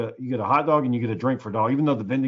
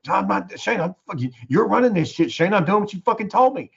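retold flustered protest, pitched up; higher, indignant self-defense; 7 semitones higher than usual. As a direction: act out a coworker pushing back in a panic. Retold panicked quarrel with coworker.

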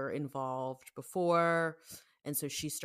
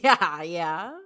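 laughing; 3 semitones higher, 12 dB louder than usual. Real, not acted. Laughs about Britney spinning.

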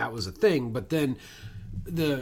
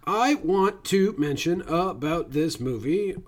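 slow, word-by-word, higher-pitched answer; deliberate stalling; 4 semitones higher, slower than usual. Acted. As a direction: space out each word while stalling. Stalling playfully when asked.

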